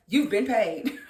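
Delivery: slow words through laughter; laughing. Real, not acted. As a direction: laugh while saying it. Laughing at Zach's stunt.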